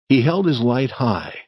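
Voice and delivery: ordinary delivery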